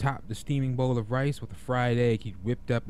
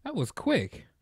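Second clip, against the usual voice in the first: surprised; 12 semitones higher, slower than usual. Real, not acted. Reacting to the sudden chapter end.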